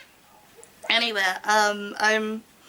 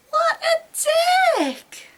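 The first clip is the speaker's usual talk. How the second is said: much higher, very slow, tearful shouted wail; outraged shout; much slower than usual. Acted. Heroine shouting through tears at the boyfriend, slow tearful scream. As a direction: scream it out, near tears with rage.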